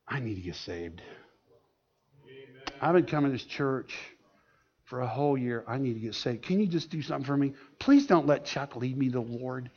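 hushed, low imitation of crying; tearful plea. Crying wife begs the pastor at the church picnic, hushed tearful plea. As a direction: act out a quiet, broken confession, well below full voice. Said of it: "she starts crying".

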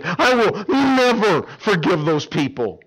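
loud, high, slow furious vow; imitating an angry teenage girl. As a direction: pound the table and swear it. Angry teen pounding table.

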